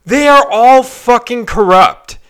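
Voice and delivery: slow, loud, every word pressed hard; hammering the point home. Real, not acted. Ranting to podcast listeners, slow emphatic stress. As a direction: drive home the main point of the rant. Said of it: "this is my point that I wanna hit home".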